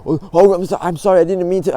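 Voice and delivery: over-apologize, all flustered and defensive; flustered apology. Shown as a demonstration. Mock flustered apology to an upset stranger. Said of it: "like, a bitch and defensive".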